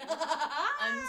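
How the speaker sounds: high, slow, laughing through words. Real, not acted. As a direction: laugh it out loud. Laughing at the joke.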